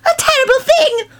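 put-on high, wavering old crone; old woman warbling. A narrator's reading. Old woman to her companion, high warbling voice. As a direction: quaver it like a wizened old woman. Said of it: "warbled his companion".

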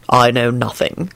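low, slow, detached reply; dispassionate. A narrator's reading. Quentin promising discretion to Elizabeth, dispassionate. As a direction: answer slowly without feeling. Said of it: "Quentin replied dispassionately".

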